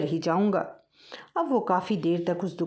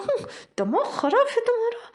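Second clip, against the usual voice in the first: annoyed retort. Acted. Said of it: "चूहिया को जब गुस्सा आया".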